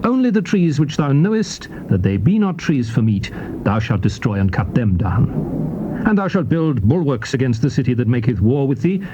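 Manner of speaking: normal talking voice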